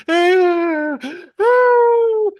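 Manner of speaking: very high, loud hammed-up shriek; mock tantrum scream. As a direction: scream right back at the toddler, over the top. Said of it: "So we both started going".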